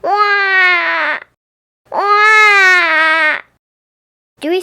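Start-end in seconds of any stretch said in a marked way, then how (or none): 0.0-3.4 put-on high, loud baby crying wail; a baby bursting into a loud wail. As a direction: cry out like a wailing baby. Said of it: "he heard a strange noise".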